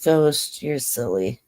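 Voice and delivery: usual speaking voice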